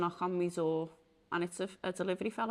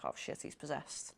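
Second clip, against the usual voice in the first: whispered, soft "oh shit"; hushed shock; 4 semitones lower, 8 dB quieter than usual. Retold or imitated. Watching the friend collapse on the couch, whispered alarm. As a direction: breathe it out in alarm.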